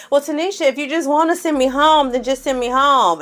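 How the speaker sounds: high whiny dramatic plea; hammed-up New York martyr. New York making it about herself.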